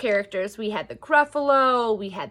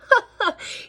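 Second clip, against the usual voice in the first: short, high put-on laugh; acted laugh; 11 semitones higher than usual. Gruffalo scoffing at the mouse, short mock laugh. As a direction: let out a hammed-up burst of laughter. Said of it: "bursting with laughter".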